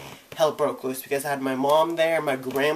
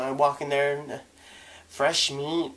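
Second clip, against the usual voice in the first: normal talking voice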